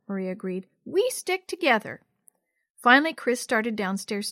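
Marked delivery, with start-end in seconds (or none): 0.8-2.0 put-on high-pitched child voice; declaring solidarity. A narrator's reading. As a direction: declare loyalty like a young girl. Sisters declare solidarity against brother.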